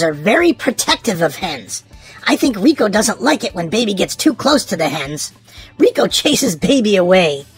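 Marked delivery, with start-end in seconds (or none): none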